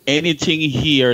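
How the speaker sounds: normal talking voice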